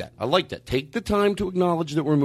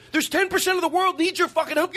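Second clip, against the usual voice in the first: heated; 10 semitones higher than usual. Real, not acted. Heated rant about church.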